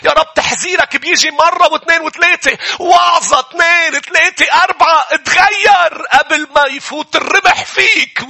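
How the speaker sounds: high, loud and pressing, warning sinners to repent; preaching at full force. Acted. Preacher warning listeners to change before too late, shouting. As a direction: thunder it out like a revival preacher.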